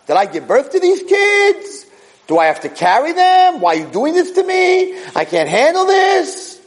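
high-pitched, hammed-up exasperated protest; acted coming unwound. Imitating an overwhelmed leader complaining to Hashem, high and exasperated. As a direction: complain as if at the end of your rope. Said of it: "Moshe Rabbeinu just totally unwound".

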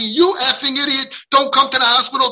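high, loud acted yelling; screaming. Injured child at the parent, acted angry scream. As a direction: imitate a hurt child lashing out. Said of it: "and now they're screaming".

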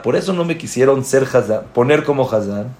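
ordinary delivery

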